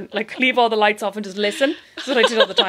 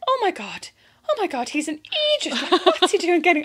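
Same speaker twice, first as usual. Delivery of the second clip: high hushed panic; imitating her horrified reaction; mock alarmed self; 8 semitones higher than usual. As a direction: gasp it out in dismay. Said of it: "And I was like".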